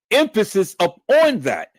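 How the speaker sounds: normal talking voice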